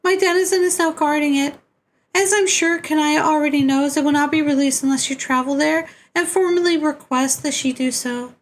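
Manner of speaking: very high put-on voice giving instructions; high-pitched otherworldly character. Character briefing players on the frog's journey, high put-on voice. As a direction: pitch it far up, sustained and steady.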